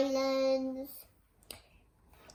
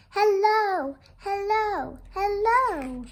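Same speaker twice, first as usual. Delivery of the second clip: high, louder repeated hellos; sing-song calling; 7 semitones higher, 6 dB louder, slower than usual. Pretend. Children greet the lighthouse with sing-song calling. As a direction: call out again and again.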